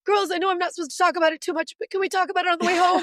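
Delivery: high, fast, eager; giddy mom in the car; excited pleading. Retold or imitated. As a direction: gush at the girls, can't hold it in.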